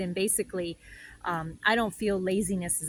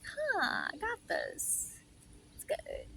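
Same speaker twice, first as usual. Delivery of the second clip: contented spirit; 10 semitones higher, 7 dB quieter, slower than usual. Retold or imitated. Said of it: "my world is just like".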